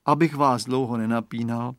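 usual speaking voice

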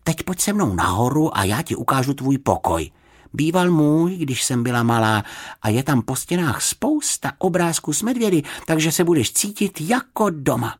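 put-on high, lively girlish character voice; friendly girl showing a guest round; welcoming girl. A narrator's reading. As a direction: chatter invitingly as a young host.

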